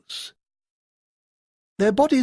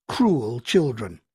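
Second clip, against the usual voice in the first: usual speaking voice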